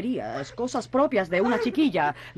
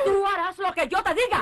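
very high-pitched imperious yell; furious command; 11 semitones higher than usual. Acted. Mother orders her rebellious daughter, furiously shouting. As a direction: override her with a shrill order.

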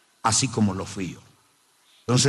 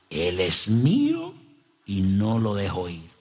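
demonic voice; 4 semitones lower, slower than usual. Retold or imitated. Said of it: "me dice con una voz así".

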